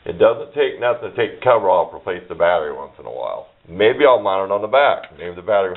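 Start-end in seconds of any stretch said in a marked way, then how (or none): none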